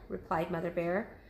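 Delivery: ordinary delivery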